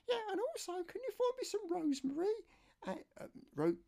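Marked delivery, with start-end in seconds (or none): 0.1-2.3 much higher falsetto voice for his niece; niece's voice. Retold or imitated. Niece phoning her uncle for help, high falsetto. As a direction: play the niece asking a favour, pitched way up. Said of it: "this is my niece Laura".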